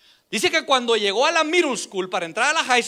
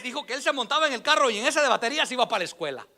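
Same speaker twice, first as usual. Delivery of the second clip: ordinary talk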